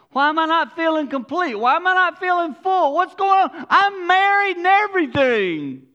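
high, loud, anguished outcry; anguished cry. Retold or imitated. A married believer crying out in despair, high and loud. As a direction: wail it like someone lost and empty.

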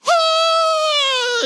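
high, loud cry of joy; joyful scream. Retold or imitated. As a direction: let out a victory scream. Relief at fine exempted.

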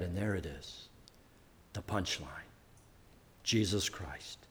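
low, quiet punchline after a dramatic pause; hushed reveal. Real, not acted. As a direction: drop right down and let each phrase land. Hushed reveal after loud scripture reading.

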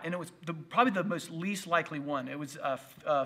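ordinary delivery

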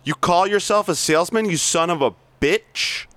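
hard-driving sales boss berating staff; bully the salesmen with contempt; reading Blake's tirade, pushy and mocking; aggressive sales boss. Plays Blake monologue.

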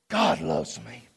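whispered, awed and low; hushed. Real, not acted. Pastor whispering the truth to church after the climax, hushed. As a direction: drop to a breathless whisper. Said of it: "hushed after climax".

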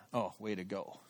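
low, soft sarcastic self-scolding; dejected self-reproach. Retold or imitated. Scolding himself after silence.

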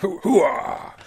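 high gung-ho hooah; loud gung-ho soldier. Retold or imitated. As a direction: shout it like a soldier. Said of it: "Little Al Pacino".

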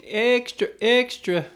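high-pitched street paper seller's call; mock newsboy cry; newspaper seller. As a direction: hawk papers like a street vendor. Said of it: "people would be screaming Echo".

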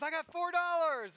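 high, loud imitation of a thrilled child; excited little girl. Imitating his daughter showing off her money, excited. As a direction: squeal it like his young daughter, mock-excited. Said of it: "she's all excited".

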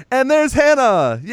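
high, loud cheer; gleeful, high-pitched celebration; excited reveal. Retold or imitated. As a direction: crow it like a big happy-ending reveal. Mock-celebrating the movie's happy reveal.

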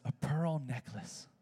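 put-on soft, slow little girl's voice; small child's answer. The little girl answering Heidi, soft and slow. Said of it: "And the girl says".

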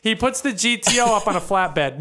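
ordinary talk

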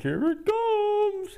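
very high, slow squeal of anticipation; excited anticipation. Retold or imitated. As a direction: stretch it out in a high falsetto, as if bracing for it. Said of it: "and I'm like".

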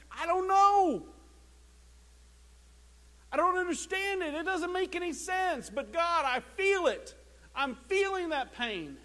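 lightly voiced anguished believer, high; hurting believer. Preacher voicing a believer in pain, mild mock anguish. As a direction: cry out in mild mock despair.

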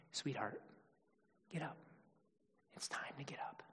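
soft, hushed and loving; a father gently rousing his little girl, barely voiced; tender whisper. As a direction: whisper it like waking a sleeping child. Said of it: "speaking her with a tenderness and an affection".

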